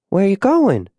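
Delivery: put-on high-pitched kid's question; child's voice. Child questions departing father.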